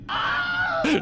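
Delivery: high squealing laugh of relief; shrieking nervous laugh. Real, not acted. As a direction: let out a shrill scared laugh. Nervous burst after the jump.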